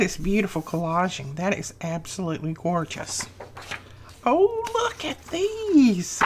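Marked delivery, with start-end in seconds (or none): 4.3-6.2 much higher, slow squeal of joy; delighted squeal. Real, not acted. Vlogger squeals over Susan's stamps, high and slow.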